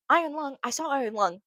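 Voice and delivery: normal talking voice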